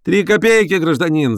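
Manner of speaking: high-pitched street seller; shouting newsboy. A narrator's reading. Boy selling papers to professor, high shout. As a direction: call out the price in a high voice. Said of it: "закричал мальчишка".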